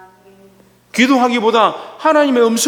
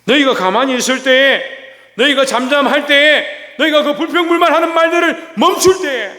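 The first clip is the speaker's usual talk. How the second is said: rising preaching; 7 semitones higher, 3 dB louder than usual. Real, not acted. Preacher building to climax.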